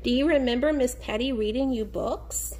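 normal talking voice

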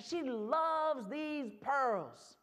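"loves" stretched very long; slow line, lingering hard on "loves"; stress on loves. A narrator's reading. Storyteller repeating the girl's devotion, "loves" drawn out.

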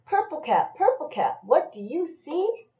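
high singsong refrain; lilting storybook call; reading to a child. Acted. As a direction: bounce the name, lift the question. Reading picture book aloud to child.

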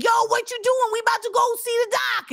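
high-pitched excited rapper impression. Rapper calling the host over on the street, hyped. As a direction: holler it up high like a hyped friend. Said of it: "that was Ghostface".

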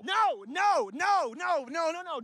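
rapid-fire alarmed string of no's; head them off urgently; urgent protest. Acted. Friend stops tourist choosing Cookie Monster, urgent protest.

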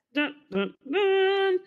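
mock suspense tune; dramatic dun dun dun. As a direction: sing it like a movie sting.

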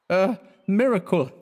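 put-on a drunk showing off a trick; tipsy showman. A narrator's reading. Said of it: "he repeated".

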